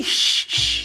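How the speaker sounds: soft unvoiced shush. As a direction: hush him gently like a baby. Hushing friend over broken cube.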